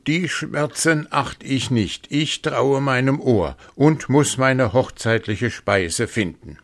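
a dazed wolf forcing bravado; stubborn wolf. A narrator's reading. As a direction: insist on the wedding feast. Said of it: "sprach er".

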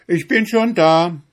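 high, loud call across the field. Retold or imitated. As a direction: shout it like you're already there. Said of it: "so rufst du ihm entgegen". (laut rufend, hoch)

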